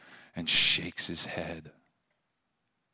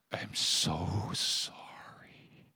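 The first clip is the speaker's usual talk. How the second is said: softer, slow, unvoiced whisper; whispered sobbing apology; 5 dB quieter, much slower than usual. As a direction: breathe it out, barely audible, as if crying. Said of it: "and sobbed".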